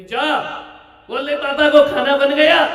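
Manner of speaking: calling out loudly across the house; mother yelling. Retold or imitated. Mother shouting to son to fetch papa for dinner, loud call. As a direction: holler it so the other room hears. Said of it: "इधर से mummy कहेगी".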